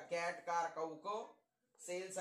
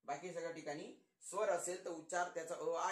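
ordinary talk

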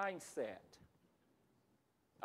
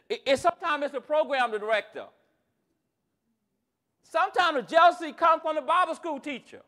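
raised, forceful sermon delivery; high, loud preacher cadence with falling ends; impassioned preaching; 16 dB louder than usual. Real, not acted. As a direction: drive each repeated line home to the congregation.

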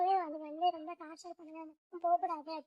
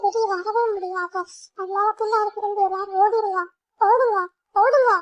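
loud, rising urgent shout; older girl yelling at the kids to flee; shouting run; 3 semitones higher, 15 dB louder than usual. Acted. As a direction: yell at them to run. Urging everyone to flee.

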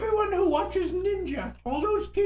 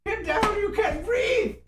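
shout; 3 semitones higher, slower than usual. Real, not acted. Yelling to get low from smoke.

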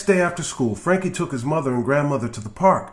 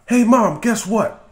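put-on boy bursting in with news, higher and louder; excited call; 8 semitones higher, 6 dB louder than usual. Frankie calls excitedly to his mom.

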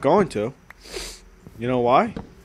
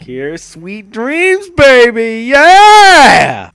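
slow, rising to a loud high yeah; hyped; 8 semitones higher, 12 dB louder, much slower than usual. Pretend. Streamer showing off, hyped. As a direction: drag it out then whoop. Drawn hype.

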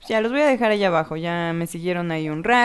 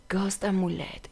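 normal talking voice

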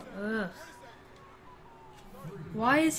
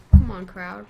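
calling out; 15 dB louder than usual. Real, not acted. Calling crowd.

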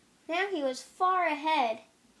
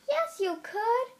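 cheering friends; 9 semitones higher than usual. A narrator's reading. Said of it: "said Tortoise's friends".

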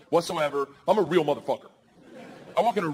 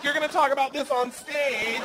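mock crying; 10 semitones higher than usual. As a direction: wail it high as a tearful wife. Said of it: "then she starts crying".

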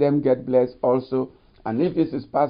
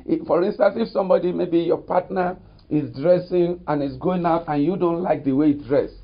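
normal talking voice

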